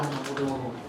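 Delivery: low, soft, aching aduh groan; pained groan. Retold or imitated. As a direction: let out a weary groan of pain. Aching body, recalling illness.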